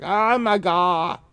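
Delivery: put-on slow, high, loud "Oh my God" in a German accent; exasperated German groan, drawn out; mock German, slow and loud. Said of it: "They'd be like".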